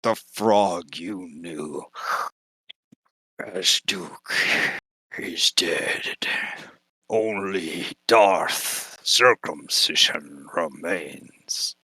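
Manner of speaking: slow, low, dark-lord voice; deep and ominous, very slow; menacing Vader voice. Acted. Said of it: "he says".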